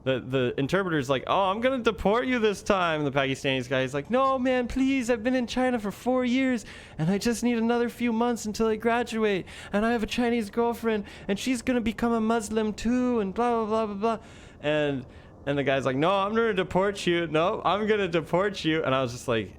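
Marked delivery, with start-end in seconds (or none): 1.3-3.0 high, teasing mock threat; joking threat. Interpreter kidding the Pakistani guy about deportation, mock threat. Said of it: "just joking around". 4.1-14.2 very high-pitched imitated begging; mock pleading. Pakistani student begging the interpreter in jest, mock pleading. Acted mock plea against deportation. 16.0-18.8 high, insistent mock threat; joking threat. Interpreter refusing the student's joke plea, mock threat. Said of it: "just joking around".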